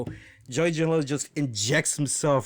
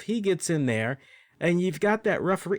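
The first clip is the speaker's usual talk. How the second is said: normal talking voice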